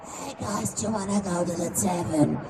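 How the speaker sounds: soft, strained, spent voice; worn-out goblin voice. Shown as a demonstration. Goblin after four hours of play, worn-out. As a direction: squeeze out what voice is left. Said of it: "it's gonna sound like".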